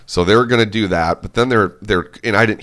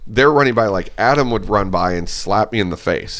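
ordinary talk

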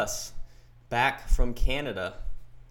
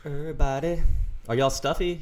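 ordinary talk, nothing marked

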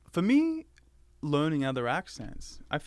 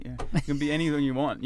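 ordinary talk

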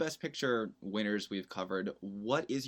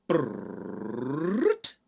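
drumroll imitation.

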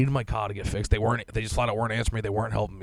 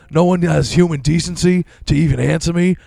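higher and louder, ranting indignantly; retold angry rant; 7 semitones higher, 8 dB louder than usual. Reading his own email to the dealer, an indignant raised rant. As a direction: spit it out like an outraged email.

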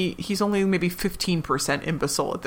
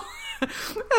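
teary laugh; 7 dB quieter than usual. Real, not acted. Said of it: "I, I was like".

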